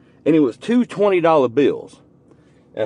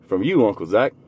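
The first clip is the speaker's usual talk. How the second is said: normal talking voice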